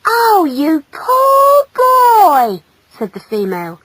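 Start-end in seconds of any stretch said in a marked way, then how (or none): 0.1-2.6 slow, high, loud pity; drawn-out girlish commiseration; gushing sympathy. Acted. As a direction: coo it out with great sympathy. Female gushes with sympathy.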